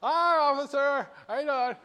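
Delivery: high, put-on hello to an officer; mock friendly greeting. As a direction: call out high and falsely innocent. Speeder greets deputy while passing.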